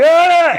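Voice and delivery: very high, loud hammed-up war cry; retold machete scream. Man running at the door with a machete, mock scream. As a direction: let out a mock charging yell. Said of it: "he starts screaming".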